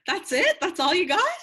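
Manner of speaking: high, loud incredulous questions; indignant comic bafflement; mock disbelief. Mock disbelief at prince's abrupt exit.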